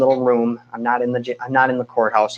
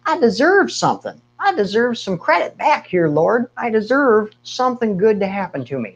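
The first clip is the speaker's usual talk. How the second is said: indignant demand; 9 semitones higher than usual. Retold or imitated. Retelling demanding prayer to God.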